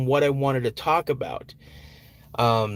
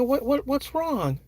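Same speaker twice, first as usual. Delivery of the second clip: higher, slow, soft concern; gentle worried dad; 11 semitones higher, 3 dB quieter than usual. Retold or imitated. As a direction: ask slowly like a caring father. Dad asking upset daughter.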